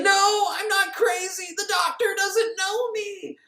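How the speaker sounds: very high, slow mock wail; hammed-up panicked pleading; acted desperate protest. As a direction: cry out in drawn-out falsetto protest. Acting man dragged off, protesting.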